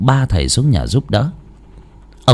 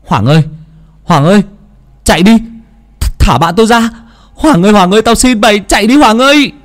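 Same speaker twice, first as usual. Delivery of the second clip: frantic scream, building much higher and louder; desperate yell; 12 semitones higher, 6 dB louder, faster than usual. Acted. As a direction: scream for your friend to run. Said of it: "mà hét lên". (hét lên tuyệt vọng)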